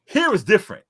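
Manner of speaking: loud, high push-back on a friend; emphatic loud retort. Real, not acted. Arguing food with a friend, loud and high. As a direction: insist loudly it's different there.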